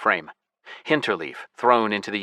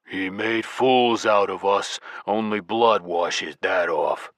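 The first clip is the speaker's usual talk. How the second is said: slow, menacing grunt; grunting giant; slower than usual. A narrator's reading. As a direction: drag out the threat. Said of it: "Colonel grunted".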